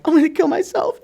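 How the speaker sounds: high-pitched, exaggerated woe; mock despair. Retelling to the room his gloom when covid hit, mock despairing. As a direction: play the sadness up as a high mock wail. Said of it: "You know, I'm so sad".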